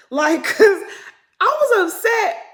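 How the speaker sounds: high, loud laugh through the words; can't hold the laughter in. Real, not acted. Host recalling being upset, laughing hard and high. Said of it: "I start laughing".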